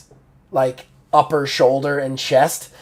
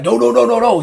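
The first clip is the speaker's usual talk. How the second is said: quick, higher, louder string of protests; alarmed protest; 9 semitones higher, 5 dB louder, faster than usual. Acted. Game master voicing a foe watching someone dig away, alarmed. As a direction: blurt it out in acted alarm. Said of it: "he's like".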